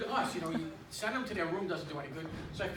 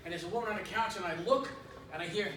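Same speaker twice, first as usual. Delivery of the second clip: ordinary talk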